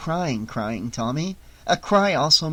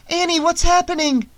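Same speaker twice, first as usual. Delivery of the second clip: high loud frightened question; alarmed; 4 dB louder than usual. A narrator's reading. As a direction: heart racing, demand an answer. Heart racing, alarmed.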